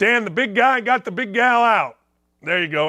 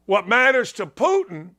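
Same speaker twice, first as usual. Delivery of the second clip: normal talking voice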